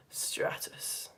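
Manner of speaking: dull hushed whisper; boring, blanketed. Shown as a demonstration. Teacher demonstrating a boring blanket cloud, bored whisper. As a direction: whisper it like a flat blanket layer. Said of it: "really boring, like, blanket layered one".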